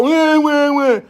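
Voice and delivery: high mock baby crying wah wah; mock baby wail. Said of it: "they might go".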